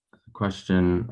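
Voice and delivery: ordinary delivery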